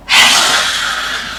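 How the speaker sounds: long open-mouthed breathy exhale; lion's breath exhale. Shown as a demonstration. Yoga teacher shows class the lion's breath, a forceful breathy exhale. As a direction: breathe out hard through the mouth like a lion. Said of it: "a lion's breath here".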